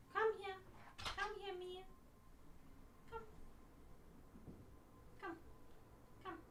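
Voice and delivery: high, soft coaxing call to a pet; high and soft, coaxing a pet over; calling the cat. Real, not acted. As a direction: coax the cat to come, sweetly.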